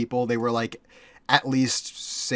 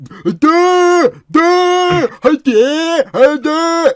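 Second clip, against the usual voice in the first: very high, loud, droning duh; mentally ill stranger's duh; 12 dB louder, much slower than usual. Retold or imitated. Imitating the stranger stepping out of the woods, high loud drone. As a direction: mimic the stranger's wordless drone. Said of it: "He was doing that".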